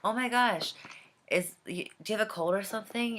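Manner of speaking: high-pitched mock surprised concern; imagined concerned coworker. Coworker noticing a changed voice, acted with high-pitched concern. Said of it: "I always expected the situation to go like this".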